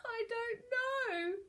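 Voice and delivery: high, drawn-out whimper; weepy pirate voice; tearful. A narrator's reading. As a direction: wail it slowly, helplessly. Sobbing pirate answers.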